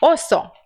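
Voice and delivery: "Osso" in Spanish, with a single hissed S; pronúncia espanhola. Shown as a demonstration. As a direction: say "Osso" the Spanish way, one voiceless S. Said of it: "Com um S só". ("Osso" em espanhol, com um S só)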